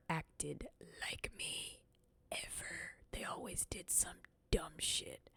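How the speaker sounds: soft unvoiced whisper aside. Real, not acted. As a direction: confide it under your breath. Aside complaint to chat.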